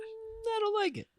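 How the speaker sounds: squeaky high put-on voice; mock verdict. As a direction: pitch it way up for a joke opinion. Joke verdict on unseen film.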